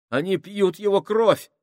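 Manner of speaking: high, loud panicked shriek; shrill cry. A narrator's reading. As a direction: shriek it out in terror. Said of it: "воскликнул Минхаус пронзительным голосом".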